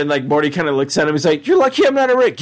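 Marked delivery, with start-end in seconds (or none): 1.5-2.4 high-pitched cartoon boy impression; Morty's retort. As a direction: put on a high voice and talk back. Acting Morty talking back to Rick.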